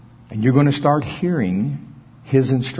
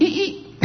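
high, thin squeal of car brakes; mock squealing brakes; 6 dB quieter than usual. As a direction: imitate brakes squeaking, much higher. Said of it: "the car went".

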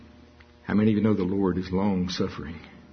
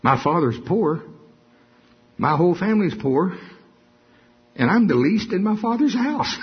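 self-pitying Gideon; 7 semitones higher than usual. Acted. Gideon excuses himself to God, higher and whiny. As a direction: pile on the excuses, climbing higher.

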